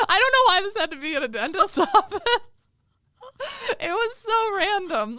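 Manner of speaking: high, loud, rushed rant breaking into laughter; real laughing exasperation. Real, not acted. Host griping to friend about the dentist scene, laughing exasperation. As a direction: blurt it out in amused disbelief.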